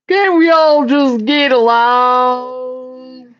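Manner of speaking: high, long drawn-out plea; mock plea. Drawn-out quote of American phrasing.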